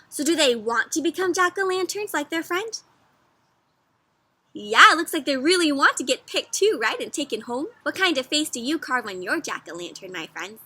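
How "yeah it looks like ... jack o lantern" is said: ordinary delivery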